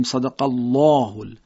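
thick, dark l in الله; الله with emphatic back l; heavy lam. Shown as a demonstration. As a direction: say صدق الله with a full "llɔh".

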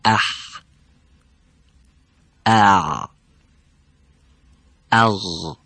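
اح with a tight throaty ح, ا with a pressed ع, اغ with a gargled غ; letter sounds demo. Shown as a demonstration. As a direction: drill اح ا اغ slowly, one by one.

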